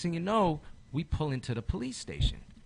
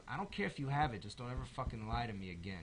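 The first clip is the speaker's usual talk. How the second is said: quiet stern warning; 7 semitones lower, 9 dB quieter than usual. Retold or imitated. Father warns son after jail.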